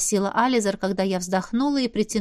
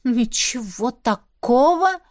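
stunned question; 3 semitones higher, 4 dB louder, much slower than usual. A narrator's reading. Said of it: "ошалела задала вопрос".

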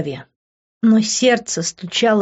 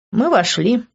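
usual speaking voice

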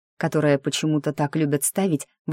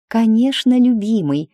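high, loud, sugary coo; fake sweet; 7 semitones higher, 6 dB louder than usual. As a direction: pout and coo it much higher. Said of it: "я вытянула губки бантиком".

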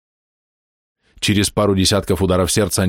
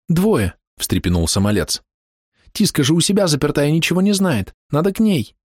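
put-on young boy voice; 9 semitones higher than usual.

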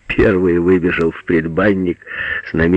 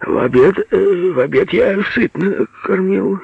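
high, halting answer; uncertain; 12 semitones higher than usual. A narrator's reading. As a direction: stumble hesitantly over the reply. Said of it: "неуверенно сказал Агеич". (неуверенно, с запинкой)

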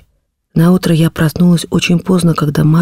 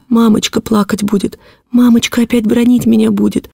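put-on high, plaintive little-girl wail, no sobs; woeful high child voice; child's lament; 7 semitones higher than usual. A narrator's reading. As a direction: complain tearfully, pitched up. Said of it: "мои жалобы и рыдания".

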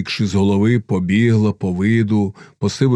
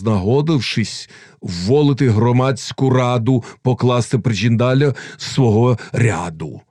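ordinary delivery, nothing marked

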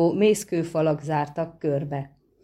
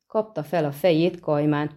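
ordinary delivery

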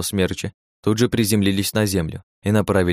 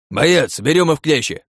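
higher, louder battle order; loud command; 7 semitones higher, 4 dB louder than usual. Acted. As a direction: bark the plan to your partner. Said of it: "скомандовал Цандер".

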